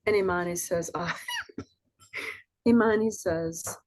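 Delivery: ordinary delivery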